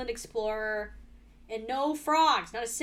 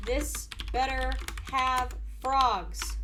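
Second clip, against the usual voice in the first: slow emphatic naming; much slower than usual. Real, not acted. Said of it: "I'm gonna call it".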